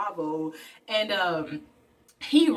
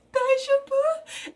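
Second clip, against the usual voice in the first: "Daijoubu?" much higher, slower and louder, rising; frantic repeat; 4 dB louder, slower than usual. Retold or imitated. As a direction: plead it anxiously a second time. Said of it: "And she's like".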